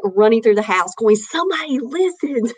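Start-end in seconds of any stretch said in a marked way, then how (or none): 1.2-2.5 retold excitement. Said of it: "I was, like, screaming".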